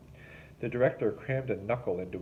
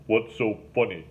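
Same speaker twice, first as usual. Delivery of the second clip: demanding; 7 dB louder than usual. A narrator's reading. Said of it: "Link demanded".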